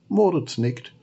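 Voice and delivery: plain reading voice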